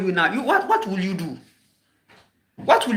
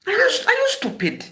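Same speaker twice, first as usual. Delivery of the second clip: much higher, shrieking in disbelief; incredulous outburst; 13 semitones higher than usual. Real, not acted. Ranting at fellow Ghanaians, high incredulous outburst. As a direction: throw it up high in outrage. Rant peak, very high pitch.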